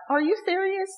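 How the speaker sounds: high, soft disbelieving question; like you can't believe what you just read; incredulous. Retold or imitated. Preacher reacting to the verse with high disbelief. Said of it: "That verse kind of was like".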